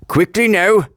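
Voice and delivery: impatient Fat Controller. A narrator's reading. Fat Controller to Thomas, waiting for him, impatient. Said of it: "waiting impatiently".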